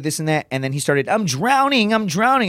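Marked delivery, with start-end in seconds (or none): 1.1-2.5 high-pitched imitated cry of panic; mock drowning panic. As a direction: play a floundering recruit, voice pitched up.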